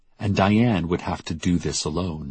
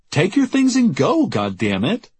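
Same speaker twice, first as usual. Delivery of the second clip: high, louder outburst at an unwanted visitor; exasperated order; 12 semitones higher, 3 dB louder than usual. Acted. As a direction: send them packing, pitch shooting up.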